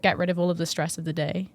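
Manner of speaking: ordinary talk, nothing marked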